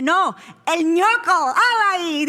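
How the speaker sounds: high, loud hammed-up correction. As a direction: throw it out like a cheeky diva.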